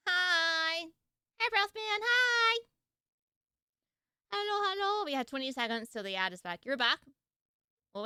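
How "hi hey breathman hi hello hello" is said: high-pitched sing-song hellos; cheery high-pitched hello; high greeting. Real, not acted. Greeting chat after ad.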